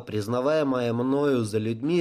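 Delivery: ordinary delivery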